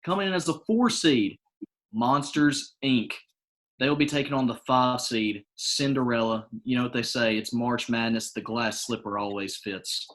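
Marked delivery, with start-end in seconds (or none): none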